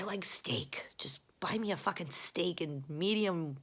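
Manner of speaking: whispered craving for steak. Real, not acted. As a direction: drop to a whisper. Whispered aside about steak.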